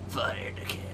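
whispered mock mating call; whispering. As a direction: breathe it out as a hushed call. Called the classic mating call.

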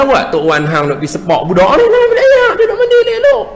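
high scolding grandma voice; grandmother scolding. Retold or imitated. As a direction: scold grandpa like a fussy old woman. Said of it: "Nenek kata".

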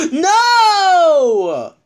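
gasp, then a high loud "No!"; shocked shout. Real, not acted. Reacting to bad news on screen, shocked shout. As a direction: cry out in dismay.

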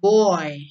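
put-on Boy with clear oi glide; model boy. Shown as a demonstration. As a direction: say Boy, rounding into oi. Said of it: "Ini by bacanya". (contoh Boy dengan bunyi oi)

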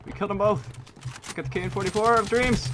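high, loud victory shout; excited cheer. Real, not acted. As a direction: crow over the double kill. Double kill in the match.

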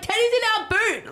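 correcting the mum. Retold or imitated. Telling the owner where her dog really is, high mock voice. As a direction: squeak out the punchline. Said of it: "I'm like".

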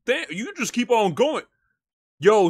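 ordinary talk, nothing marked

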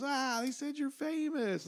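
slow high coo, mimicking an impressed fan; imitated woman. Woman tells comic what she heard, high drawn-out imitation. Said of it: "She goes".

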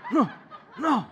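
tiny, halting refusal; short mock-intimidated squeaks; imitating intimidated kids. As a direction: mimic children suddenly too shy to speak. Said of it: "They got so intimidated".

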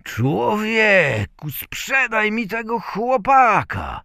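loud, high, stretched-out opening; excited gentleman. A narrator's reading. Amazed gentleman begs to buy. (podekscytowany pan, głośno i wysoko)

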